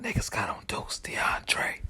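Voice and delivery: hushed, lost whisper to himself; whispered muttering. Retold or imitated. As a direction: whisper it over and over. Muttering alone outside.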